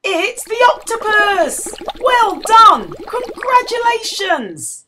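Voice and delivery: high, loud cheering announcement; excited. Acted. Reader cheers the octopus as winner, high and loud. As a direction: cheer the winner like a prize-giving.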